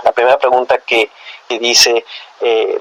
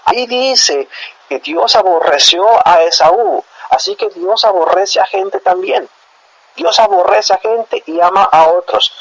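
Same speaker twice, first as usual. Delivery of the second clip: higher, mocking voice of a smug know-it-all; mocking the calvinists; 7 semitones higher, slower than usual. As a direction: parody the opponents crowing over their proof text. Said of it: "Y dicen ahí". (voz burlona imitando a los calvinistas)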